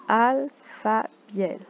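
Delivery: "Alpha Biel" drawn out slowly as one name; slow name reading. Shown as a demonstration. Teacher reading a name card slowly to the class.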